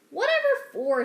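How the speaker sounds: high, loud indignant retort; annoyed. Acted. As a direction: snap back, royally put out. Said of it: "in an annoyed tone".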